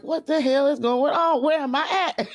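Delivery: high-pitched, hammed-up confusion; comic imitation of a baffled onlooker; mock bewilderment. Voices Orlando's bewildered look.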